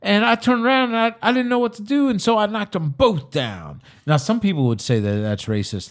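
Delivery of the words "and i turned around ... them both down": high-pitched comic storyteller voice; worked-up punchline delivery in imitated accent; Kevin Hart impression. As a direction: mimic a stand-up's accent, voice pushed up high. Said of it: "I did that accent right there".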